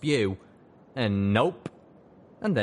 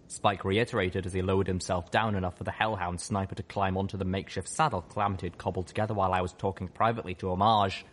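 plain reading voice

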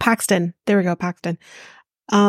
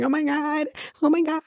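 high, softer imitation wail; mock crying; 7 semitones higher, 4 dB quieter than usual. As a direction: whimper it in parody of an ugly cry. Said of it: "You're the one that's crying".

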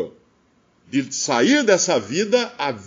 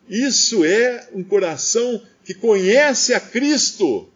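ordinary delivery

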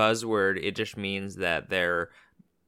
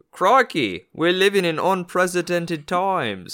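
hammed-up Australian voice, higher and louder; mock Australian accent; 7 semitones higher, 5 dB louder, slower than usual. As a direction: toss it off like a cartoon Aussie.

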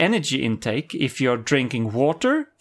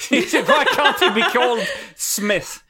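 laughing, higher and brighter; 7 semitones higher, 4 dB louder than usual. Real, not acted. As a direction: laugh through the question. Laughing at hard name.

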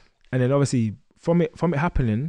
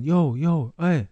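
raised, higher hailing voice; imitated calling out; 5 semitones higher, 5 dB louder, much slower than usual. Boys calling after him off the bus, imitated hail. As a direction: mimic boys trying to get someone's attention. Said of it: "they started calling me".

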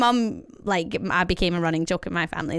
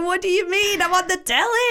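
can't believe what gran said; incredulous; 10 semitones higher than usual. Retold or imitated. Granddaughter answering her gran in disbelief. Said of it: "I was just like".

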